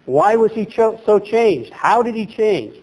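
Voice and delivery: usual speaking voice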